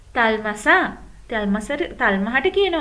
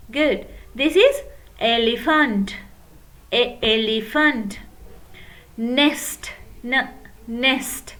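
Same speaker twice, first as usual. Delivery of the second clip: normal talking voice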